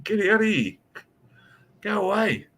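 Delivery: hammed-up order to get off his property; retold angry dismissal. Homeowner ordering the apologising cop off his property, mock-angry. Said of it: "I was like".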